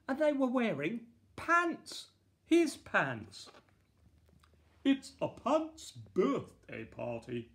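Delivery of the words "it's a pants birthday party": announcing. A narrator's reading. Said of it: "announced the Queen".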